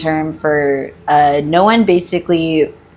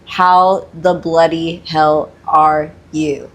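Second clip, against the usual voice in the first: slow, word-by-word translation at usual pitch; slow clarification; much slower than usual. Shown as a demonstration. Explaining what the film character really says, slow and distinct. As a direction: spell out each word slowly and plainly. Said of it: "but he actually does say something".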